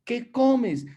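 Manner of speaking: pregunta aguda y entusiasta; curiosidad admirada. Retold or imitated. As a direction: preguntar asombrado, mucho más alto. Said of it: "la gente me decía". (la gente pregunta su secreto, voz aguda y admirada)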